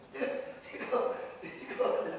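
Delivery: chuckling through a false start; words breaking up into a laugh. Real, not acted. Official amused while discussing ten-billion homes, laughing.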